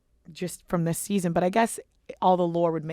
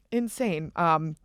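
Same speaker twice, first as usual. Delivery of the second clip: ordinary talk, nothing marked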